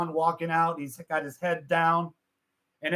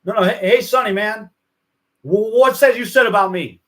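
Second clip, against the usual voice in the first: raised, louder retold challenge; annoyed Elvis; 5 semitones higher, 6 dB louder than usual. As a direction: call him out as an irritated Elvis. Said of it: "Elvis is annoyed".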